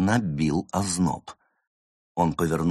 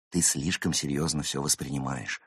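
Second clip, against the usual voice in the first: lecturing; 4 semitones lower, 9 dB quieter than usual. Acted. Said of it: "назидательно сказал Улис".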